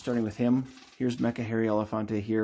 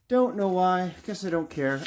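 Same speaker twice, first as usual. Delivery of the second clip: robot voice; 8 semitones higher than usual. Pretend. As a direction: push the robot voice up. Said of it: "Again, the robot voice".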